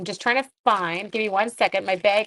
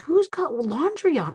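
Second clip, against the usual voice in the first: higher, puzzled question; puzzled surprise; 7 semitones higher than usual. Retold or imitated. Finding the washer running, puzzled higher question. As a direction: wonder who started the wash. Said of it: "I was like".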